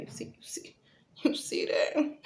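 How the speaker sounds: whispered, teasing the camera; playful whisper. Real, not acted. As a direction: whisper it like a secret brag. Showing off her muscles.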